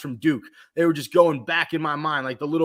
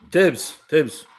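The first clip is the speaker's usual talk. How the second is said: lightly imitated coach Tibbs calling out; Tibbs voice impression; 4 dB louder, slower than usual. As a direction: bark the name like a coach, only a hint of an impression. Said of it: "that's the Tibbs voice".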